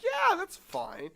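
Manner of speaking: high, slow, drawn-out mock approval; breezy teacher. Teacher okaying a jazz standard for the jury, breezy imitation.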